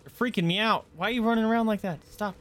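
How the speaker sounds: high, fast alarmed voice; rattled and rushing the words; freaked out. Real, not acted. Creeped out by running NPCs.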